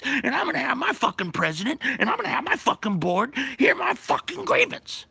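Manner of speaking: furious rant. Retold or imitated. Joe rants at the union board, high furious shouting. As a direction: rage at the whole room.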